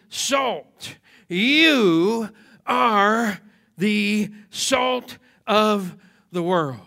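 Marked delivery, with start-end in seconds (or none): 1.3-6.8 "You" held long, every word set apart; very slow, weighty preaching from "You" on; stress on YOU. Real, not acted. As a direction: stretch "You", pause between the following words. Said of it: "The emphatic word is you".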